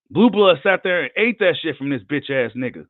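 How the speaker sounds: ordinary talk, nothing marked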